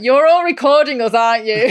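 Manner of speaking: loud, high, laughing tease; laughing accusation. Retold or imitated. Friend teasing the crew who record them, laughing.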